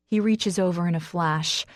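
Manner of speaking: ordinary delivery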